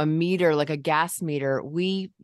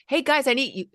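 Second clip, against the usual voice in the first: plain loud call; 9 semitones higher, faster than usual. Shown as a demonstration. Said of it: "instead of like".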